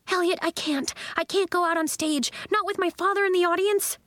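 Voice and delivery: high-pitched, rising stage panic; panicky protest. A narrator's reading. Nori tells Elliot she can't go on stage, panicky. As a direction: plead in a high, anxious voice.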